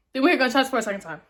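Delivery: "gunshots for a second time" in one fast run; quick announcement. Real, not acted. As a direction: rush through "gunshots for a second time" without pauses. First take, then repeated slowly.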